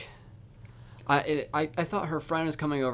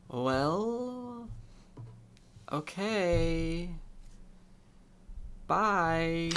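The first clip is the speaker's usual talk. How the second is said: put-on disappointed girl voice; 4 semitones higher, much slower than usual.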